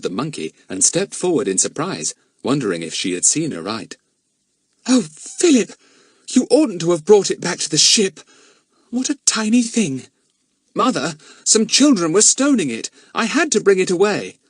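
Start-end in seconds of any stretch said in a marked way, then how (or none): 4.9-10.1 high, loud surprised mother. Acted. Mrs Mannering voiced. 10.7-14.4 put-on boy voice. Philip explains.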